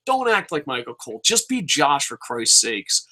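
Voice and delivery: normal talking voice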